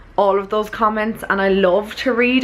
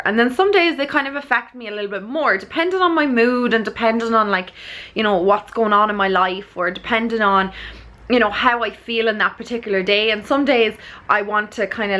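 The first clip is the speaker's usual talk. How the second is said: ordinary delivery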